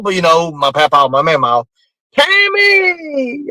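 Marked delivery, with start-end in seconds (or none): 2.1-3.5 high drawn-out holler of a name; calling out. Retold or imitated. Mama hollering a name across the holler, high drawn call.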